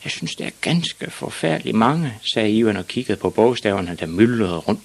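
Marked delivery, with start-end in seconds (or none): 0.0-2.1 put-on high-pitched child's worry; high, plaintive boy voice; overwhelmed small boy. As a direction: insist like a daunted little pupil. Ivan despairs of letters.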